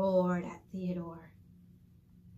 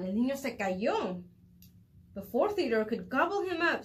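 ordinary delivery

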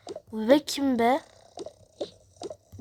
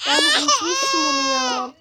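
laughing; 10 semitones higher, 7 dB louder than usual. Real, not acted. Laughing cry.

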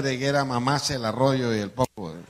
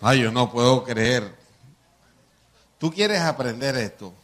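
ordinary talk, nothing marked

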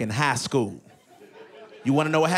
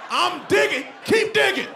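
straining player; 13 semitones higher than usual. Retold or imitated. Player answering his coach, high strained mock yell. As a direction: yell back you're trying hard. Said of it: "I'm like".

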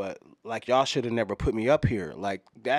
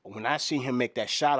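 ordinary delivery

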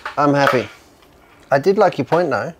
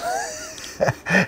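high burst of laughter; 6 dB quieter than usual. Real, not acted. Laughing at three holes.

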